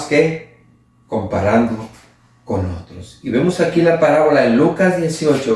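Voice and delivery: usual speaking voice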